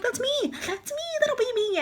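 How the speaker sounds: high, squeaky self-mocking voice; high-pitched self-mockery. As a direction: squeak it in mock alarm. Sees herself in parking-fail commercials.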